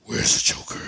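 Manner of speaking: loud, rasped interrogation without pitch; Batman voice. Retold or imitated. Tackling a woman for answers, Batman voice. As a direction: growl it out like a masked vigilante. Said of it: "your Batman voice on".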